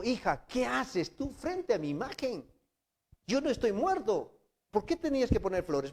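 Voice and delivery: father's rebuke. Acted. Father scolding daughter at photo.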